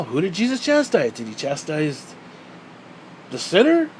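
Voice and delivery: usual speaking voice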